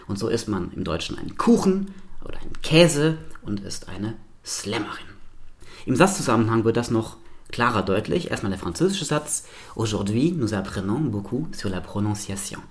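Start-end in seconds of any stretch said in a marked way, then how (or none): 1.4-5.0 whispered, stressing the first syllable of "Kuchen", "Käse", "Slammerin"; German first-syllable stress. Shown as a demonstration. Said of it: "auf der sogenannten Stammsilbe betont". 9.7-12.6 flowing French with a soft wave-like rhythm; French sentence. Shown as a demonstration. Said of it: "Das geht runter wie Butter".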